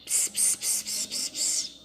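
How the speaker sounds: hushed secret-sharing whisper. Acted. Julieta gossiping into Carol's ear, whispering. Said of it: "a Julieta cochicha no ouvido da Carol".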